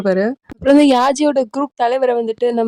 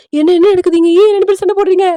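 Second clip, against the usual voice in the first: high-pitched, acted yell; alarmed shouting; 6 semitones higher, 4 dB louder than usual. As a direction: cry out to stop a fight. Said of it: "அப்படி சொல்லி கத்திட்டு இருப்பா".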